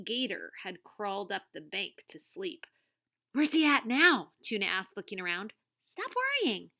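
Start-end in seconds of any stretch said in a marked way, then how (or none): 3.3-4.3 higher, louder worried question; a touch of alarm, pitched up; anxious question. Acted. Tuna asks Wahoo about the gator, anxious. 5.8-6.7 much higher, impatient voice; impatient reassurance. Acted. Wahoo tells Tuna off for fretting, impatient. Wahoo brushing off Tuna's worry.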